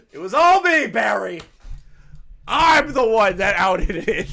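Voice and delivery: high, loud hammed-up shout; mock confession yell. Streamer hams up taking the blame, shouting high. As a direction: yell it like a proud villain confessing.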